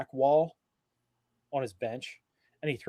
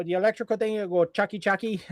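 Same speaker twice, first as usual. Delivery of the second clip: Tio Papi; 5 semitones higher, faster than usual. Retold or imitated.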